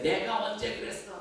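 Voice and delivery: high indignant snap; indignant denial. Retold or imitated. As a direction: deny it hotly. Other driver denies blame.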